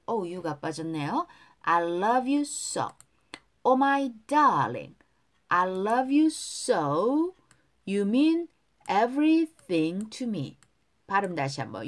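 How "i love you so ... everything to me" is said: slow, careful model reading for repetition; pronunciation model. Shown as a demonstration. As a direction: say each phrase slowly for learners to copy. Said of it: "후렴구 발음 따라할게요". (따라 읽도록 천천히 또박또박)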